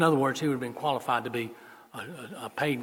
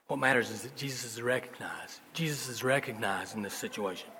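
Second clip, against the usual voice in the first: hushed whisper, softer; 3 semitones lower, 6 dB quieter than usual. Real, not acted. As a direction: lean in and whisper the key point. Preacher's hushed key point.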